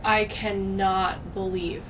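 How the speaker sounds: ordinary delivery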